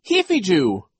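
put-on high, loud baby babble; baby sister's voice. A narrator's reading. Little sister agrees with her siblings, piping up. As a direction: pipe up loudly in baby talk.

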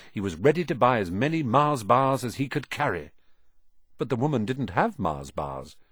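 plain reading voice

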